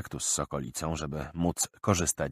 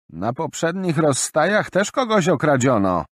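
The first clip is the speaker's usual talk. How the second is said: higher, louder pointed reminder; old merchant woman; 9 semitones higher, 6 dB louder than usual. Acted. Grey old woman reminding the caravan, stern. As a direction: recall the earlier theft sternly.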